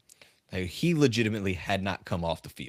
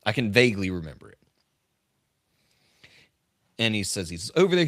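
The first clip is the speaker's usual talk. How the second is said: ordinary talk, nothing marked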